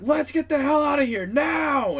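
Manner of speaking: high, loud captain's yell; shouted order. Acted. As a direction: holler the retreat across the whole ship. Marco orders the escape from the helm.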